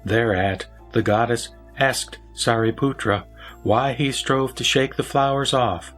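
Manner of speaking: usual speaking voice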